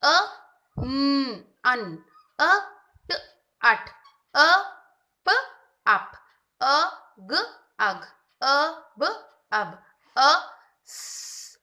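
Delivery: slow, loud sound-by-sound blending; teacher blending two-letter words for a child; phonics blending. Shown as a demonstration. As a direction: say each sound, then join them. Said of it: "किन किन letters की blending बनती है".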